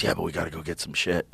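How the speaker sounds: low junkie mumble, hurried; mock Water Dog. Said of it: "He goes".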